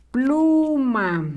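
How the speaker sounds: Pluma stretched out, long PLUUU; slow dictation. Shown as a demonstration. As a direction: draw out both syllables of Pluma. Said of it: "La sílaba plu va con rojo".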